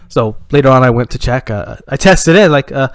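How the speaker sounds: ordinary talk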